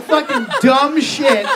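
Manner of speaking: usual speaking voice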